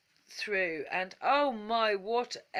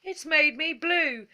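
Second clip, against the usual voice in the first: sobbing; 8 semitones higher, 7 dB louder than usual. A narrator's reading. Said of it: "sobbed Bubbly".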